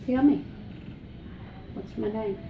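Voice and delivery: ordinary talk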